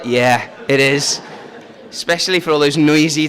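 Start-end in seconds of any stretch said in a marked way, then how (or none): 0.0-1.2 low, slow mock agreement; imitating agreeing adults. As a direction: draw it out low as the grown-ups' knowing yes. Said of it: "Some of the adults are like".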